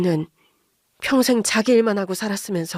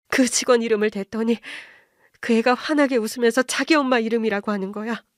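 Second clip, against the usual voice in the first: usual speaking voice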